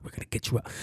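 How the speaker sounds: breathy whispered reassurance. Retold or imitated. Security guard whispers reassurance to trapped comic. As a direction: whisper it close to him. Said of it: "He's like".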